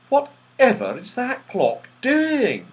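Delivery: high and slow amazed question; astonished. A narrator's reading. Silky watches the dancing clock in astonishment, high and slow. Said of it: "said Silky in astonishment".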